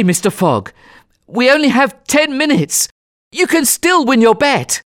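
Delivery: high, thrilled, insistent voice; excited urgency. Acted. Passepartout telling Fogg he can still win, excited. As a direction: urge him on, bursting with the news.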